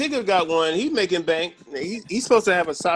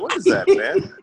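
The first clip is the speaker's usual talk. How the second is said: high, laughing "What is that, man?"; half-laugh the question; laughing disbelief; 9 semitones higher, 4 dB louder than usual. Retold or imitated. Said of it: "I was like".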